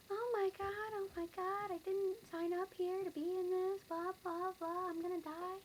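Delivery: high, frantic mock wail of a crying teacher; panicked teacher. Teacher at the alarm, mock crying in a high voice. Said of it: "the teacher starts crying".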